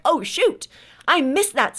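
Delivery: a sudden high "oh shoot", caught off guard at camp; startled realization. Retold or imitated. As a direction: act out realizing you missed a step, half-laughing at yourself. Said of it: "And I was like".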